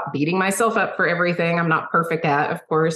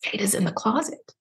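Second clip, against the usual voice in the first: softer hushed retold whisper; 6 dB quieter than usual. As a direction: pass on a secret quietly. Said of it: "I hear her whisper".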